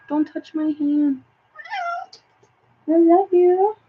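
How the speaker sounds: high, drawn-out cooing; affectionate pet talk. Real, not acted.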